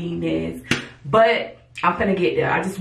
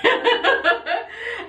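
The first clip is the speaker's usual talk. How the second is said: high laugh; 14 semitones higher than usual. Real, not acted. Laughs at own promise.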